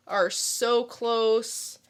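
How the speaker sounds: ordinary talk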